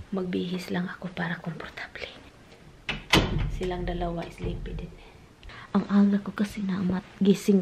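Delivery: soft whispered vlog. Real, not acted. Sleepy vlogger whispering to viewers before a nap, hushed. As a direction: whisper so as not to wake anyone.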